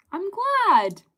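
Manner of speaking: high, loud gush of delight. Real, not acted. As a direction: let it leap up, really pleased. Happy reply to a viewer's message.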